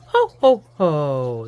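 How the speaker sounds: hearty Santa laugh. Acted. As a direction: boom it out like jolly Santa.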